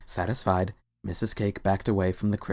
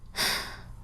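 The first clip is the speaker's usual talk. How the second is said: soft weary exhale; tired sigh; 7 dB quieter than usual. A narrator's reading. Tired husband sighing at his wife, soft sigh. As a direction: let out a long tired sigh. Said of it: "another tired sigh".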